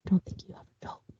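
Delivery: fast, hushed whisper; timid whispered retort. Retold or imitated. Acting out Millie answering her accusing boss, timid whisper. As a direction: imitate a reply barely dared aloud.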